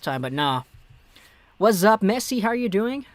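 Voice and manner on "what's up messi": ordinary talk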